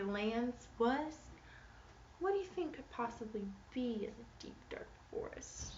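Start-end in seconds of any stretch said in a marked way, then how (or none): 2.2-5.7 hushed, mysterious whisper; spooky whisper. Acted. Reading to kids, whispering a spooky question. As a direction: whisper it like a secret in the dark woods.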